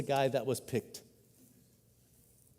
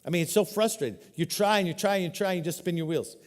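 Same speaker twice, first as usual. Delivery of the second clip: normal talking voice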